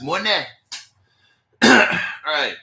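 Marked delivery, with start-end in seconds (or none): 0.0-0.3 "money" quickly as "moh-neh"; fast "moh-neh". Shown as a demonstration. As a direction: snap through "money" with a "neh" ending. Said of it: "Now say it fast".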